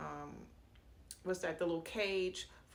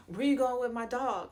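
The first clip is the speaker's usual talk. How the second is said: louder, higher mock confrontation; retold indignant challenge; 5 semitones higher, 8 dB louder than usual. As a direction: demand an answer, imitating the man. Retold challenge from dog's owner.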